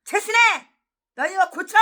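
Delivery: a high, loud call of her name, then the order; scolding call. Retold or imitated. Someone correcting the preacher, a loud high scolding call. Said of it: "누가 딱 지적을 해주는 거예요".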